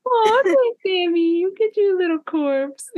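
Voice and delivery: very high, hammed-up cooing; mom talking to baby. Pretend mom giving Sammy a little corpse, high baby talk. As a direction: indulge a baby in a mock mommy voice. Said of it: "like a mom talking to her baby".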